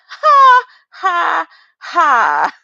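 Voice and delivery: sharp shouted ha bursts; loud ha. Real, not acted. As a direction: bark out loud laughing ha's. Bursts out at screen.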